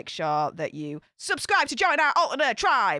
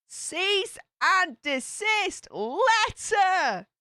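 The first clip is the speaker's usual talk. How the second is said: slow, high "Cease and desist letter"; dramatic reveal; 9 semitones higher, much slower than usual. Real, not acted. Gossip reveal for dramatic effect.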